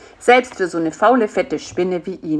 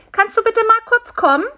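high, loud, quick call; high-pitched little fly calling; Bisi; 5 dB louder, faster than usual. A narrator's reading. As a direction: call over pleadingly in a tiny high voice. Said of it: "rief Bisi".

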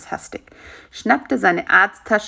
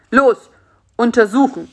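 loud, higher curt order; commanding; 4 semitones higher, 7 dB louder than usual. A narrator's reading. Spider orders the captive doctor to examine, commanding. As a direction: order someone to get to work. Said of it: "befahl Karl-Heinz".

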